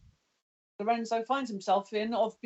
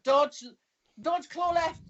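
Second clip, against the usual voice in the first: raised, urgent combat call-out; half-yelled, higher-pitched heads-up; yelled warning; 6 semitones higher, slower than usual. Acted. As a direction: call out a quick warning to an ally. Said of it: "so I yell out".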